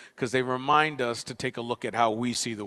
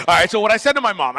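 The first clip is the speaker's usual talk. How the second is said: excited correction; 7 semitones higher, 10 dB louder, faster than usual. Real, not acted. Correcting the joke, hyped.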